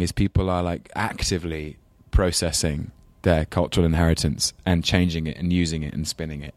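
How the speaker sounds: normal talking voice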